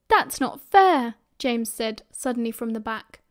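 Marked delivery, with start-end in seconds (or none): 0.1-1.1 loud, slow, higher-pitched protest; drawn-out, indignant complaint. Acted. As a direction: object as a wronged schoolboy. Boy protests at punishment.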